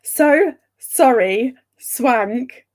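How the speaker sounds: slow, deliberate so sorry; determined slow. Real, not acted. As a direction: force the words out carefully. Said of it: "I was determined".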